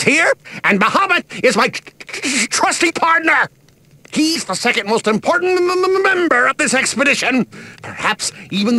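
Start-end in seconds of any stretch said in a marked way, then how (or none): 0.6-7.4 tripping over every other word; stuttering. Acted. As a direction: stammer out the boast, flustered. Stammering over his own boast.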